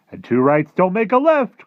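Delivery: high, loud chiming in; giant's cry. Acted. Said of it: "cried the B F G".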